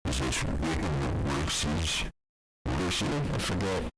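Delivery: loud mock on-air voice; satellite radio host. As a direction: ramble loudly on air as a rant host.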